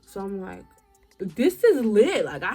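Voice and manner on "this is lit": loud, slow, drawn-out hype; hyped. Retold or imitated. Girl bragging to herself about her credits, loud slow hype. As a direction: belt it out like you struck gold.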